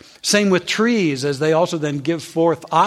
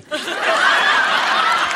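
long, high, breathless laugh; 11 semitones higher than usual. Real, not acted. Preacher laughing at own joke. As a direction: crack up at the punchline.

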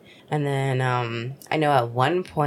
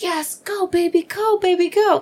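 high, rising chant that gets louder; mock cheerleader cheer; 4 dB louder than usual. Urging her husband on silently, high-pitched cheer. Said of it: "I was like".

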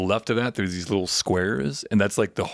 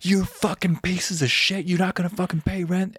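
furious yelling; 10 semitones higher than usual. Retold or imitated. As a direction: yell at the freeloaders. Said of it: "she was like".

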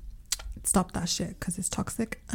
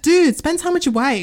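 louder, higher telling-off; exasperated retort; 7 semitones higher, 6 dB louder than usual. Retold or imitated. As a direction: snap it like a fed-up reply. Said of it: "I'm like".

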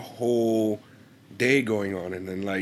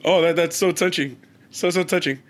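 mock touched; 5 semitones higher, 4 dB louder than usual. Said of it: "he goes".

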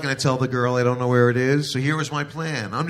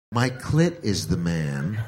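slow explaining; 6 semitones lower, slower than usual. Acted. Wife explaining slowly.

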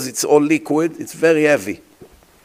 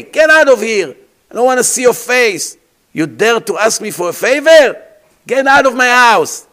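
furious shout, higher and louder; angry throw-out; 6 semitones higher, 5 dB louder than usual. Acted. Shimon refusing Reuven, throwing him out: angry shout. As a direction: bark him out of the house. Man throws away favor-seeker.

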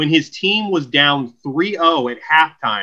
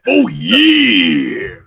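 big drawn-out mascot bellow; Kool-Aid Man; 5 dB louder than usual. Acted. Pitcher mascot crashing through drywall, exuberant drawn-out bellow. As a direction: burst through the wall with a stretched YEAH. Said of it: "I was a young Kool-Aid man".

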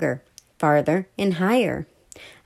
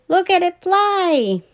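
high, slow, delighted call; excited boy; 11 semitones higher, slower than usual. A narrator's reading. Billy watching the plane climb, delighted. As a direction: point up in wonder.

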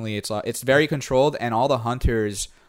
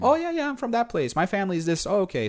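much higher, sing-song imitation of locals chatting; mock chatty locals; 9 semitones higher than usual. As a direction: act out both locals in a high lilting voice. Small-town exchange acted out.

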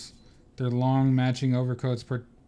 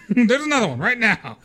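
angry teacher; 8 semitones higher, 4 dB louder than usual. Acted. Vernon adding detentions.

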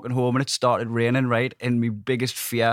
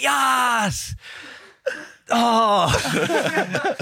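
high, excited yelp of victory; mock triumphant yes; retold cheer of triumph; 9 semitones higher than usual. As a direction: relive the moment you beat your best. Beating his PB, retold triumph.

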